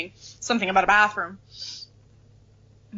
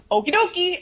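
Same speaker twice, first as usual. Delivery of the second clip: high, breezy okie dokie; chirpy agreement; 10 semitones higher than usual. Retold or imitated. McGonagall agreeing to Harry at once, breezy and high. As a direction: agree brightly, no questions asked. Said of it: "she's just like".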